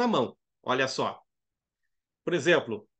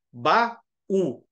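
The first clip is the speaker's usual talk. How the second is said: "Ba-u" in two separate beats, louder; split into syllables; 3 semitones higher, 5 dB louder than usual. Shown as a demonstration. Teacher showing a hiatus, "Ba-u" pronounced syllable by syllable. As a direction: break "Ba-u" between the A and the U. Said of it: "separadas por sílabas".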